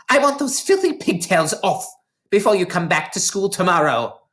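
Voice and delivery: high, harsh headmistress bark; a bully teacher barking at a pupil; barked order. A narrator's reading. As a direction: bark the command at the child. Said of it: "she barked".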